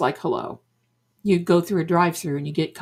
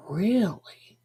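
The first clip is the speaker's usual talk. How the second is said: whispered disbelief; 4 dB quieter than usual. Retold or imitated. Customer doubting the psychic's promise, whispered. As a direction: whisper it, letting the word fall. Retelling her sceptical reply.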